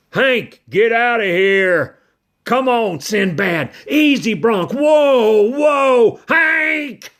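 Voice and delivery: loud high shouts at a bucking horse; cowboy yelling. Acted. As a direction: bellow at the dog and rein in the colt. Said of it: "He started yelling".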